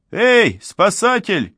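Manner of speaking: very high, loud call; high-pitched frantic shout. A narrator's reading. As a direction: yell for attention in a high voice. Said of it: "крикнул Глушков".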